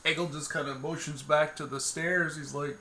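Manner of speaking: ordinary talk, nothing marked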